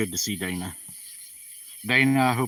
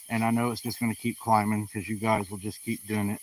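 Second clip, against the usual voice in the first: ordinary delivery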